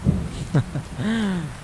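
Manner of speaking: short mocking laugh at a silly tale; dismissive chuckle; scoffing laugh. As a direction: laugh off the ghost story. Friend scoffs at ghost story.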